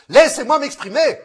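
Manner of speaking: loud, insistent outburst; demanding to speak. Acted. Actor demanding the floor in a heated quarrel, loud. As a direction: cut through the others to be heard.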